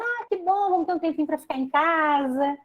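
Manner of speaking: light pretend glee at staying home; mock delight. People glad about lockdown, lightly voiced cheerful delight. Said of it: "muitas pessoas dizendo".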